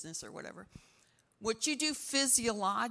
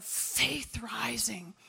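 whisper; 2 semitones higher, much slower than usual. Real, not acted. Sermon point, dropped to a whisper.

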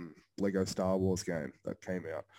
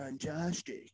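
mock accent, higher and softer; imitated accent, raised pitch; Caleb's accent; 5 semitones higher, 5 dB quieter, much slower than usual. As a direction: mimic his accent on a gushing catchphrase. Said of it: "He's like".

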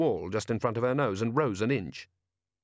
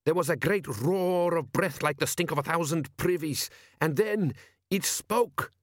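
higher, slowing, awed dread; frightened lookout; 6 semitones higher than usual. Acted. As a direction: build the horror of the monster. Lookout describes monster.

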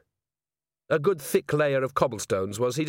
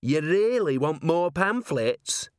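high, drawn-out incredulous question; slow, hopeful disbelief; amazed hope; 5 semitones higher, slower than usual. Acted. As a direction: check it, hardly daring to believe. Zealot asks again in disbelief.

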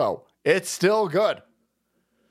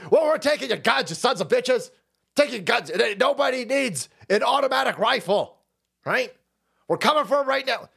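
higher, lightly hammed-up threatening rant; mock gun-control politician; 6 semitones higher than usual. Streamer parodies politicians vowing to take guns, mildly hammed-up. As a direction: play a smug official announcing the seizure. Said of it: "Well, hold on".

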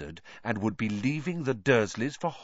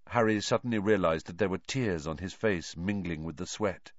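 usual speaking voice

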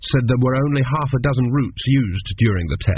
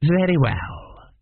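cold examiner; 6 semitones higher, much slower than usual. Acted. Examiner accepts the answer.

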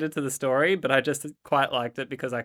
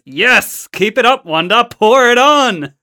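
loud, high rallying cry; excited cheering-on; 9 semitones higher, 12 dB louder, slower than usual. Acted. Podcast host reads Wonder Woman urging on Wonder Man, rallying shout. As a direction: shout it, stretching the last words. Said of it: "Wonder Man says".

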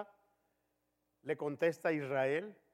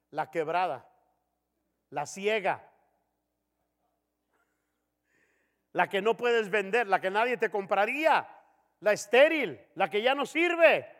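mounting indignation; 2 semitones higher, 6 dB louder than usual. Retold or imitated. God lists bad offerings.

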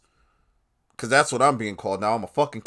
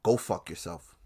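quiet brush-off; 6 semitones lower, 6 dB quieter than usual. Pretend. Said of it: "So I'm just gonna say".

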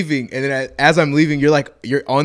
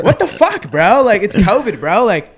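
much higher, louder outraged bro voice, laughing through it; over-the-top indignant bro impression; imitating the partygoer; 8 semitones higher, 7 dB louder than usual. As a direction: squeal his disbelief like the guy did. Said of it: "that impersonation is fucking crazy".

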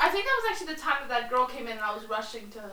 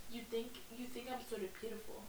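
soft quote; 2 semitones lower, 20 dB quieter, faster than usual. Retold or imitated. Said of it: "he's like".

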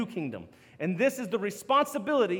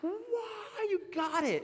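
put-on high, gushing cheer for a kid's win; proud parent cheering a child who got a sight word, high and gushing; excited praise for a child; 10 semitones higher, 5 dB quieter, much slower than usual. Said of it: "it's like".

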